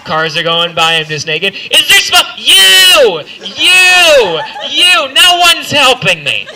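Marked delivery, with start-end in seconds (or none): 1.5-6.5 high, loud desperate shout; hammed-up roadside plea, much higher and louder; mock yell for help. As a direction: cry out to passing cars. Naked on highway, crying out.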